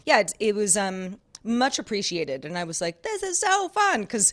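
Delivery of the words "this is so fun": high, giddy exclamation; gushing delight. Retold or imitated. Host retelling reaction to coffee gift, gushing delight. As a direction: gush over a new gift.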